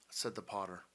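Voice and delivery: ordinary delivery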